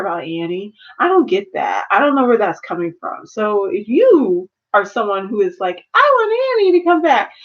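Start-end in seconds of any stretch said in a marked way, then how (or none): 5.9-7.3 mock fan voice. Mocking fans who want Annie.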